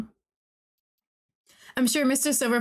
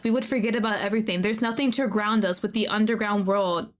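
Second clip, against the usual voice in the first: ordinary talk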